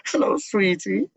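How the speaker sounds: high, lilting Trinidadian greeting; put-on Trinidadian shop lady voice; imitated Trinidadian gal. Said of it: "there's this Trinidadian gal".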